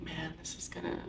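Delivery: sussurro sommesso, senza voce; whisper. Real, not acted. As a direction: confida a bassa voce, rassegnata. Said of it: "whisper heard".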